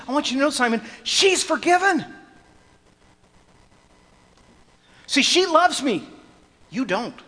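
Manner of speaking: loud, high, driving sermon voice; impassioned preaching. Acted. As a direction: preach it full force to the congregation. Preaching at full force.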